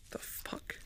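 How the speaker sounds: hushed unvoiced whisper. Real, not acted. Streamer whispering in the dark house, hushed. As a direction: breathe it out in fear.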